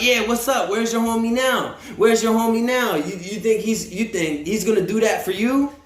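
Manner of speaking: imitating a jeering challenge; talking shit. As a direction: goad him mockingly, pushing the pitch up. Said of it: "they're talking shit to him".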